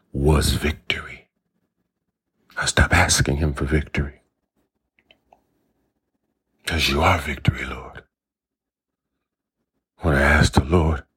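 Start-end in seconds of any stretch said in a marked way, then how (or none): 0.1-8.0 hushed whisper. Real, not acted. Intimate prayerful aside to the Lord.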